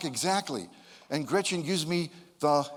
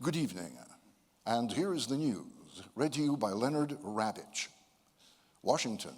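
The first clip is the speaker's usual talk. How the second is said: deep, grave news anchor; evening news opener in a much lower, softer voice; serious broadcasting voice; 5 semitones lower, 5 dB quieter than usual. Retold or imitated. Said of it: "with that very serious broadcasting voice".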